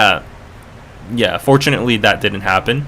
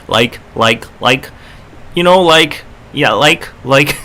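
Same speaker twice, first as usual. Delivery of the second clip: put-on Cali girl fillers; 3 semitones higher than usual. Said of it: "Subini's the Cali girl accent".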